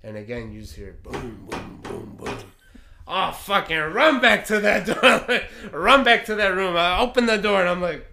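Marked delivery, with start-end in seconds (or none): 3.1-7.6 loud, high, worked-up storytelling; excited shouting. Retold or imitated. Storyteller reliving his run back to the door, shouted excitedly. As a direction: belt out the frantic dash, laughing. Excited retelling of dash to room.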